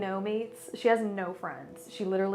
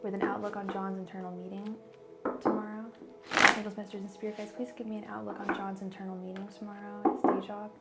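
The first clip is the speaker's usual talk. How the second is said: rapid invocation; 4 dB quieter, faster than usual. Acted. Repeated invocation.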